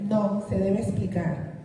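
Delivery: ordinary delivery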